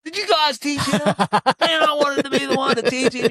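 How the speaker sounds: high, far-off mock whine of a jealous coach; mad coach. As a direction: squeal it like a sulking grown man who missed his chance. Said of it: "Yeah, he was mad".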